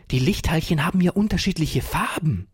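put-on excited child calling out. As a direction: blurt out the discovery with excitement. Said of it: "rief Ferdinand aufgeregt".

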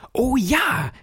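put-on overjoyed boy crying out; joyful cry. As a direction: squeal with delight. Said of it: "rief Ferdinand voller Freude".

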